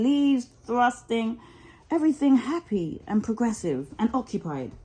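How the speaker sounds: ordinary delivery, nothing marked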